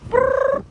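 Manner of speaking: very high rolled brrr; high trill. Real, not acted. Owner calling the bird, high trilled brrr.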